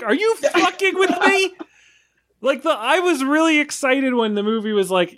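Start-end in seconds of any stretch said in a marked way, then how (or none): 0.0-1.5 high, incredulous outburst; mock disbelief, voice shooting up. Recalling watching the movie, he fires off an incredulous, high-pitched outburst. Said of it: "And I was like".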